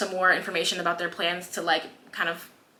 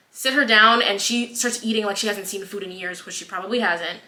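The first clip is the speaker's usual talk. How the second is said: ordinary talk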